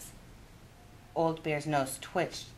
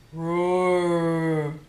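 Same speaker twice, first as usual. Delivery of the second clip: a drawn-out, louder roar; bear roar; 5 dB louder than usual. Acted. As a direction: roar like a big old bear. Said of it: "Old Bear roared".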